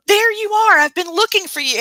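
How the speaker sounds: high, thrilled greeting; excited greeting. Retold or imitated. Guest retelling meeting him at MassCon, excited greeting.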